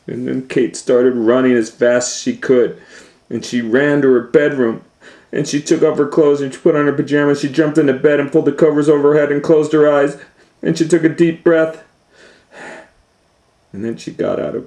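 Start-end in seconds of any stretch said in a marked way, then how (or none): 0.1-11.8 loud, fast breathless telling; faster, louder, higher telling; urgent narration. A narrator's reading. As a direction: rush through it.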